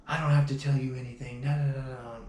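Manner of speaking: mocking her refusal. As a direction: parrot her stubborn refusal dismissively. Quoting her repeated refusal.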